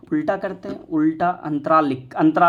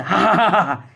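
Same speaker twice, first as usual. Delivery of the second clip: big open ha-ha-ha laugh, louder; 4 dB louder than usual. Shown as a demonstration. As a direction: laugh out loud to show the kids. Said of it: "करके हंसिए, खूब जोर से हंसिए". (खूब ज़ोर से हा-हा करके हँसना)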